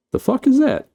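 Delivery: very high squeaky facehugger voice; mock creature voice. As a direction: squeal it as a puzzled little creature. Voicing the alert facehuggers.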